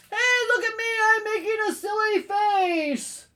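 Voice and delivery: high, loud, stretched-out holler; calling for attention. Acted. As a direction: shout like a child showing off. Chameleon desperate to be noticed.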